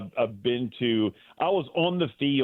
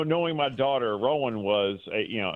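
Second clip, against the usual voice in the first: normal talking voice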